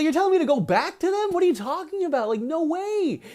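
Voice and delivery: high-pitched, exasperated acted refusal; incredulous protest. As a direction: argue back in disbelief as the disciple. Disciple protesting guru's order.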